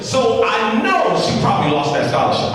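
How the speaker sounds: ordinary talk, nothing marked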